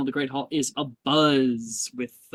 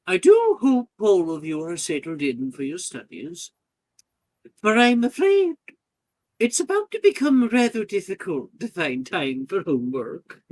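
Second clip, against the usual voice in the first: loud, higher headmistress addressing a hall; 6 semitones higher, 8 dB louder than usual. Acted.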